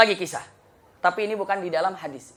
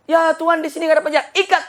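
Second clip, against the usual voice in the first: angry order; 8 semitones higher, 7 dB louder, faster than usual. Acted. Guard replies, king orders.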